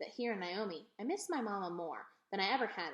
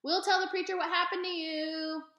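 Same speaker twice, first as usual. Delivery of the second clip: put-on high, loud taunting boy; high taunting shout from behind; shouted after her; 9 semitones higher, 6 dB louder than usual. A narrator's reading. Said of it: "Dunlap shouted after me".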